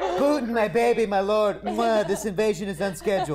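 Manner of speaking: a hint of Trump, fawning; lightly voiced Trump flattery. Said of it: "uh, Trump".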